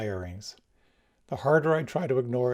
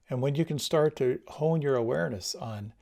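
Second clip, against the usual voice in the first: ordinary talk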